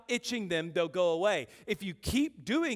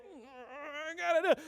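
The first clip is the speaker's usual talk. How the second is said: high, slow mock struggle; straining against an urge; 9 semitones higher, slower than usual. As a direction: squeeze it out while resisting an itch. Acts out fighting urge to scratch.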